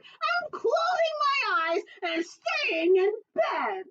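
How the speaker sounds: high, slow whiny critter voice; sulky groundhog. Acted. Groundhog refusing to leave bed, high and slow. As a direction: whine it out from under the covers. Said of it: "the groundhog said".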